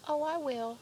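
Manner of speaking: high, loud breezy promise; tossed-off husband reply; mock husband voice. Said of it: "he always says".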